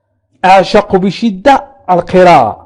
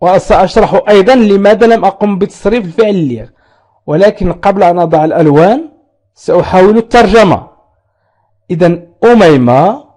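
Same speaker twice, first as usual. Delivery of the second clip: ordinary delivery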